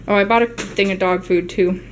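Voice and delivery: ordinary talk, nothing marked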